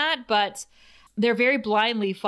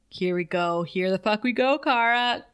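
ordinary delivery

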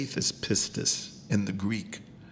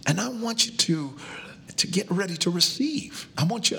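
rising preacher call; 9 semitones higher than usual. Real, not acted.

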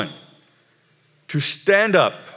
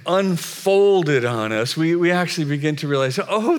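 ordinary delivery

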